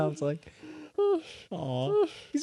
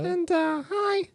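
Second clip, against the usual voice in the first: very high shy puppet voice; timid little puppet saying hi; Susie puppet voice; much slower than usual. Acted. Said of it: "my name's Susie".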